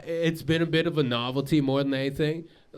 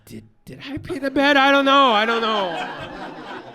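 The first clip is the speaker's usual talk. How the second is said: bewildered self-doubt; 7 semitones higher, 5 dB louder than usual. Retold or imitated. Doubting himself, voiced panic.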